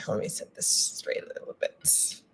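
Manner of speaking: muttered under breath; whispered aside. Real, not acted. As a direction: whisper to yourself while fiddling. Adjusting camera, muttering to herself.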